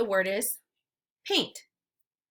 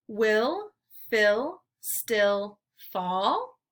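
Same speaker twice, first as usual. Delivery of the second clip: slow model sentence; much slower than usual. Shown as a demonstration. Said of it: "slow model sentence for children".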